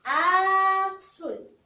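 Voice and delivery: Azul said loud, with the A opened wide; loud teacher model of Azul; emphasized model word. Shown as a demonstration. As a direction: hit the first A of Azul for the child. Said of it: "Inicia con la vocal A".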